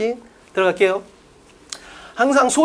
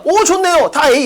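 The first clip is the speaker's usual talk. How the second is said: higher, louder imitation of yes-men; eager group agreement; 7 semitones higher, 5 dB louder, slower than usual. Whole group answering the leader's proposal, eager agreement. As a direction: pipe up as if all keenly agreeing.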